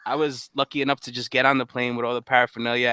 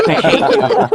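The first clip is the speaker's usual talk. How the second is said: high, loud mock outburst of hatred; imitated yell; 9 dB louder, slower than usual. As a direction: blast it back like the scared friend did. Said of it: "he's like".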